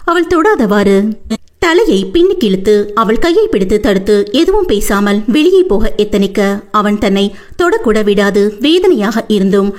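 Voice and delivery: ordinary delivery, nothing marked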